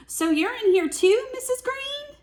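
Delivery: high, rising mock surprise; hammed-up princess surprise; surprised princess. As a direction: discover a friend inside the belly. Princess surprised inside dragon.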